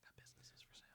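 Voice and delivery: furtive whisper; hushed, secret sales pitch; shady alley seller. Acted. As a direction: whisper like a back-alley dealer. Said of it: "that guy in the alley".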